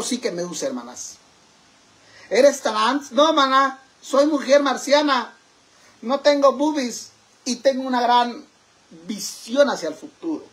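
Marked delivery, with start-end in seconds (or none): 2.3-9.7 mock loud retort. Acted exchange, loud.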